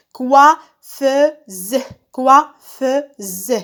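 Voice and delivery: coiffeuse split into three even beats; syllable by syllable. Shown as a demonstration. Teacher helping pupils find the wa sound, syllable by syllable. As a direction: sound out coiffeuse as COI, FFEU, SE. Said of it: "Je frappe les syllabes".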